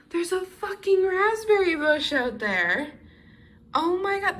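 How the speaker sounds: delighted find; excited. Real, not acted. Discovering the raspberry bush.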